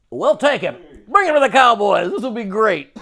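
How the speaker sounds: high, loud gleeful boast; cheerfully oblivious team owner; mocking the owner's eager signing, loud and high. Imitates Jerry Jones.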